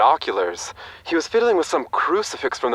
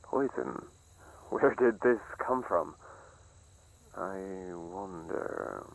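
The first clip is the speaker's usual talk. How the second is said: very soft sinister voice; sinister whisper; 8 semitones lower, 14 dB quieter, slower than usual. Acted. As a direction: breathe it out slowly. Sinister aside.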